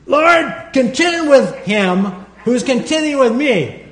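high, slow, hammed-up furious plea; angry prayer. Preacher showing how he prayed the psalm, mad. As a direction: pray it like a demand for payback. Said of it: "I was praying it this way".

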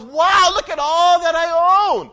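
loud, high, slow boast; a turtle king boasting from the top of the stack; proud exclamation. Acted. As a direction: crow over everything you own like a little king. Yertle atop turtles, boasting.

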